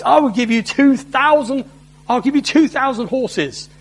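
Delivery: mock them with a scornful offer. Assyrian envoy mocking Judah's weak army, in a sneering voice. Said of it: "This mocking voice".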